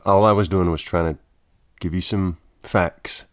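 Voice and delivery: ordinary delivery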